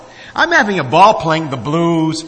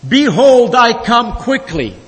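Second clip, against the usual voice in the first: raised, higher proclaiming voice; announcing; 7 semitones higher, 6 dB louder than usual. Acted. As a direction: announce the verse boldly. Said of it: "So he announces his text".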